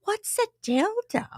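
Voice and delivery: put-on high, puzzled old lady voice; innocent grandma. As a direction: naive grandma asking. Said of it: "And she was like".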